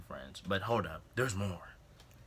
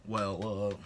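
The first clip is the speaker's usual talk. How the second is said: imitating Kyle; 4 dB louder than usual. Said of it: "this is exactly what he did".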